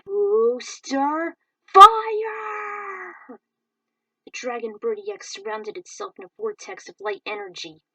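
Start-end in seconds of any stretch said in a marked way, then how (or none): 0.0-3.4 long drawn-out screamed attack; battle cry. Acted. Rick finally unleashing his attack, screaming. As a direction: hold the shout out with everything. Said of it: "Rick found the courage".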